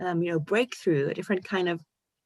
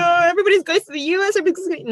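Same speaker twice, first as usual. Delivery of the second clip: much higher, louder and fast mock protest; mock whiny protest; 13 semitones higher, 7 dB louder, much faster than usual. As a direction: whine it like a sulking teenager. Mimicking her own teenage protest.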